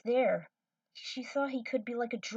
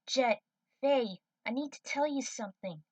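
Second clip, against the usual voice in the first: put-on a higher young woman, earnest; lightly voiced young cadet, urgent; young cadet pleading with her crewmates; Trudy's voice; 5 semitones higher, 6 dB louder than usual. Trudy speaks to crew.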